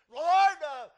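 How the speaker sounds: high, slow, full-force shout; impassioned cry. Acted. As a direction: cry out to the Lord from the pulpit. Preacher cries out apostle's words.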